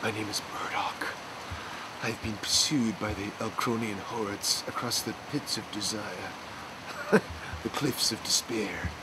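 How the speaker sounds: soft, slow, hushed hero announcing himself; fantasy hero voice. Pretend. Pretend movie hero Murdoch telling of his flight, hushed and slow. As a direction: brood like a loincloth action hero. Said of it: "I think my name will be Murdoch".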